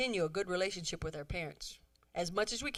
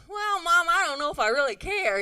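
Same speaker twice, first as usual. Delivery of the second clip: mock teen whine, higher and louder; imitating a bored teen; sassy daughter; 7 semitones higher, 8 dB louder than usual. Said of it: "they say".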